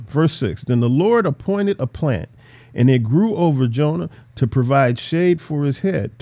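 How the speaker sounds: ordinary talk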